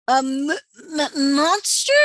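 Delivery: stammering in fright, rising high; frightened stammer on monster; scared stammer. A narrator's reading. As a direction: stutter it out scared. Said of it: "said Mater".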